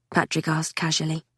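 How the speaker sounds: ordinary delivery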